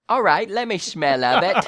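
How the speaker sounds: comic stab at sounding English; attempted English accent. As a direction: have a laughing go at an English accent. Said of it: "I've actually got an English accent".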